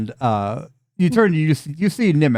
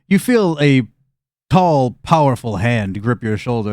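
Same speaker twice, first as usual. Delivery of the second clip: normal talking voice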